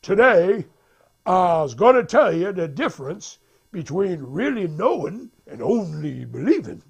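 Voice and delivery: loud, emphatic preacher delivery; prédicateur. Retold or imitated. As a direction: proclaim it like a preacher to the congregation. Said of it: "the preacher says".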